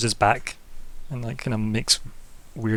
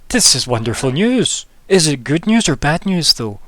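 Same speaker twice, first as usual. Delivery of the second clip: put-on higher and louder, thrilled old villager; eager old man; 5 semitones higher, 6 dB louder than usual. Old man greets the gooey herald, eager and loud. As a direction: gush over the herald's wonderful news.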